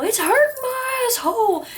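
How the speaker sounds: very high, loud mock shriek of labor pain; imitating a birthing scream. Vlogger imitating a woman screaming in childbirth. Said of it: "high-pitched screaming".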